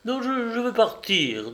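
high-pitched, stammered insistence; impatient, stumbling on the first words. A narrator's reading. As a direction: cut in impatiently, pitched well up. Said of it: "dit Julie d'un ton d'impatience".

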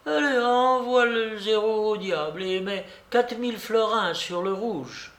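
put-on high-pitched fed-up old lady; exasperated babouchka. (vieille dame excédée, voix aiguë)

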